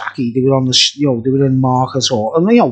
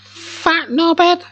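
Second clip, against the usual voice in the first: mock insult; 14 semitones higher, much slower than usual. Mocking insult at himself.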